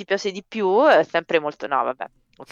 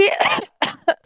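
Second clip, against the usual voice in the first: a real, rough cough from the chest; chesty cough. Real, not acted. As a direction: cough hard, then recover. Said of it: "scusa, avevo della tosse". (tosse di petto)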